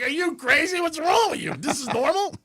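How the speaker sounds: high-pitched, incredulous retelling; aghast questions, pitched high; mock disbelief. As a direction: imitate an appalled therapist, voice shooting up. Therapist reacting in disbelief.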